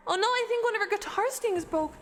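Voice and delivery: high, shocked announcer cry; alarmed. Acted. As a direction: gasp it out in dismay. Announcer alarmed at the broken string.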